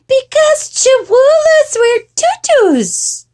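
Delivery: put-on high, slow child voice; little sister. A narrator's reading. Jezebel explaining tutus to mama, high singsong. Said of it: "said Jezebel".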